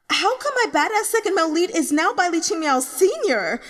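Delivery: high, loud, disbelieving rant; shocked outburst. Acted. The reader character discovers his favourite lead's identity, shocked. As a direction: blurt it out in total disbelief.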